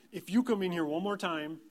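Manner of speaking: loud, high warning threat; exasperated scolding. Retold or imitated. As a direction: re-enact losing patience with a kid. Retold exasperated dad scolding his son.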